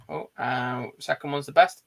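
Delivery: ordinary delivery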